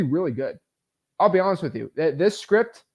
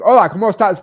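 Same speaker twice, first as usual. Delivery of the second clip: Spanish greeting; 5 semitones higher, 9 dB louder, faster than usual. Retold or imitated. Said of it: "it sounded like I was from Mexico".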